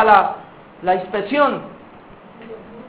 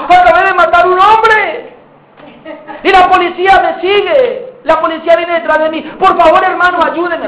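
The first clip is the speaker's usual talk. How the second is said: desperate confession; 5 semitones higher than usual. Acted. Brother confessing a killing to his brother, desperate. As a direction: blurt it out in terror. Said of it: "así desesperado".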